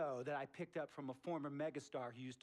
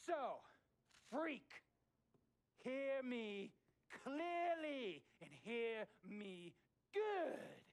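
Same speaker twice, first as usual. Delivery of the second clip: emphatic ultimatum; 10 semitones higher, much slower than usual. Acted. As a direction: spell it out like a final verdict. Owner spelling out ultimatum.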